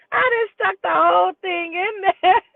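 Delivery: high, loud, slow through laughter; laugh-crying. Real, not acted. Storyteller at her own mishap, laugh-crying shout. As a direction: wail it through tears of laughter.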